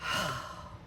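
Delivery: low breathy sigh of awe; real emotional sigh; deep sigh. Real, not acted. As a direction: let out an overwhelmed breath. Overwhelmed after unboxing.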